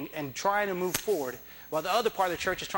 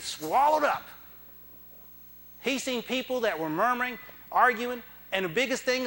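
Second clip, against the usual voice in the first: fervent preaching; 3 semitones higher than usual. Acted. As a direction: preach it, pressing each line home. Preaching at rising force.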